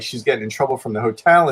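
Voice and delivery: ordinary delivery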